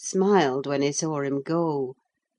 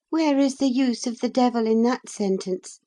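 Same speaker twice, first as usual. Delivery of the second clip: tittering; 7 semitones higher than usual. Acted. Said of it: "tittered Linton".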